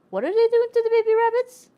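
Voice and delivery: high, loud horrified cry; a high, loud, appalled yelp; alarmed squeal. Real, not acted. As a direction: shriek it in shocked disbelief. Alarmed at nun's rabbit remark.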